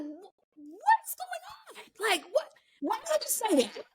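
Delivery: high, stretched-out disbelief; mock bewilderment. Retold stunned reaction.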